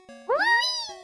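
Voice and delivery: high gleeful whee. Acted. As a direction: squeal with joy. Riding flower.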